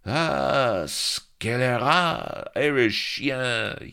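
high, loud, drawn-out French pirate cry; hammed-up French pirate yelling at his rival; French accent cry. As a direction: crow it out like a ham Frenchman. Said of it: "he cried".

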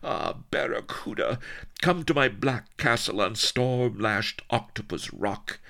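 high-pitched, passionate seductress; panting pirate queen. A narrator's reading. Pirate queen luring the man to her castle, high and panting. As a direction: pant it out as an ardent invitation. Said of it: "she panted".

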